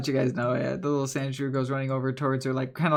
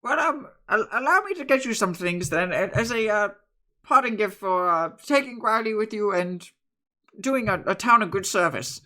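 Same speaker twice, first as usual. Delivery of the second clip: lightly voiced, fussing old woman; old woman insisting; 6 semitones higher, 4 dB louder than usual. Bread woman offering gifts to the group, lightly voiced. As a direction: insist kindly like a granny.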